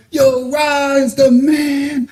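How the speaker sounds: high, drawn-out mock hollering, held back in volume; mock yelling. Dudes hyping their New York friend to everybody, mock yelling. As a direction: imitate hype men announcing their homie to the street. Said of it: "they was just yelling in the street".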